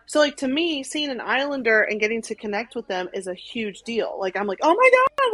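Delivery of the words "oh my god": high, loud starstruck squeal; re-enacted fangirl freak-out. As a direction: squeal it like meeting a celebrity. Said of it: "like, I'm freaking out".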